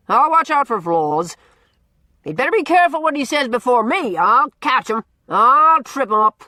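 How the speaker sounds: put-on loud, gruff, teasing old man holding forth; blustering old Norman. A narrator's reading. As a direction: boom it out like a cranky, likeable bully. Norman boasts.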